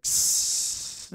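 soft unvoiced scraping hiss; blade scrape hiss. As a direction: hiss like steel on the floor. Imitating blade drag.

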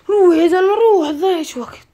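loud impatient urging; loud hurrying. Real, not acted. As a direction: push him to hurry, loudly. Hurrying the player along.

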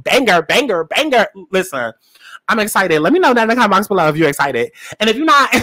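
usual speaking voice

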